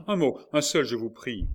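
high, loud begging; pleading cry. A narrator's reading. Marguerite begs Tavannes to stop, loud and pleading. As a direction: beg for just one word. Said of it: "s'écria Marguerite".

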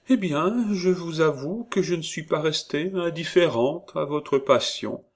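prim woman feigning shyness; voix minaudière. A narrator's reading. Perrine confesses. (aveu hésitant et minaudier)

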